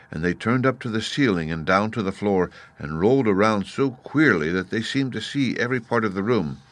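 plain reading voice

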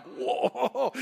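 amazed whoa with a laugh; wowed by her all over again. Real, not acted. Husband reacting as his wife enters the room, laughing whoa.

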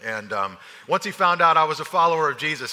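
ordinary talk

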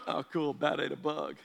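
low, soft, unimpressed shrug; bored. Retold or imitated. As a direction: say it like it's nothing. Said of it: "we're just like".

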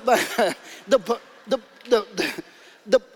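chuckling through the words, still amused; laughing. Real, not acted. Pastor laughing at his own accent joke, chuckling. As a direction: let the laugh spill into the restart.

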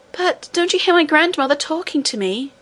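put-on a girl's high, tearful plea, held back from crying; almost crying. A narrator's reading. Princess begging Curdie to hear her grandmother, nearly crying. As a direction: plead on the edge of tears. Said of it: "almost crying".

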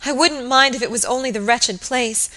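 high, agitated voice building to a wail; distracted distress. A narrator's reading. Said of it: "sobbed the girl distractedly".

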